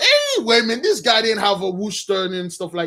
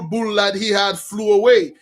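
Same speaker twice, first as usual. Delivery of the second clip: ordinary talk, nothing marked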